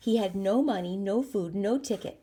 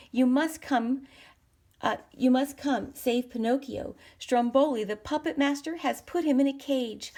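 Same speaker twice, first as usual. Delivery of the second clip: urgent plea; 6 semitones higher, 3 dB louder than usual. A narrator's reading. Jiminy urgently asks for help.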